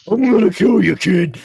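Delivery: loud, high menacing yell; intimidating threat. Acted. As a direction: roar the threat to scare the kid.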